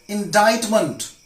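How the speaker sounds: "indictment" drawn out, long i, no c; silent C in indictment. Shown as a demonstration. Teacher shows learners "indictment" said in-DITE-ment. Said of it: "not indictment".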